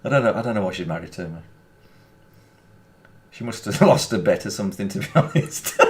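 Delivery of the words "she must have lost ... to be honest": words breaking up into laughter; laughing joke. Real, not acted. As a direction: crack up at your own joke. Joking about his marriage.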